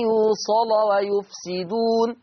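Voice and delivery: يوصل ويفسدون slow, lam of يوصل heavy, run on; heavy lam in joining. Shown as a demonstration. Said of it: "حكم اللام وصلا التغليظ".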